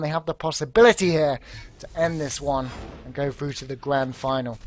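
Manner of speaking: usual speaking voice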